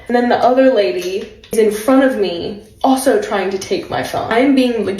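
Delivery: normal talking voice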